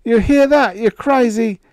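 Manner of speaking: high and loud, frantic; frantic yell. A narrator's reading. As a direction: shriek it at the machine. Higgins shrieking at robot.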